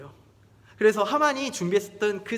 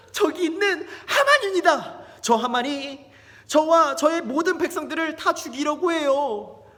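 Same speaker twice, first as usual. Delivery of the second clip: high, loud, urgent plea; desperate, raised-voice denunciation; distressed accusation; 9 semitones higher, 7 dB louder than usual. Acted. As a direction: act a queen crying out against her enemy. Queen tearfully accuses the villain.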